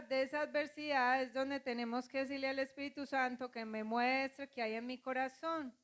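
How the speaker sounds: usual speaking voice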